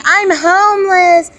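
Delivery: slow, high mock yell of HOMELESS; retold scream. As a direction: stretch out HOMELESS like a half-hearted scream. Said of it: "he's screaming".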